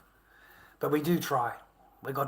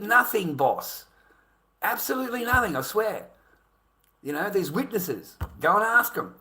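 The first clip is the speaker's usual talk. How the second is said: high, loud, insistent denial; retold indignant plea of innocence; protesting innocence; 9 semitones higher, 5 dB louder than usual. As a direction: swear you did nothing, acting it out. Retells swearing innocence to boss.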